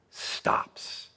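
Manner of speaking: soft, unvoiced reverent hush; hushed whisper. Real, not acted. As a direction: drop to a bare whisper. Dramatic pause on beautiful words.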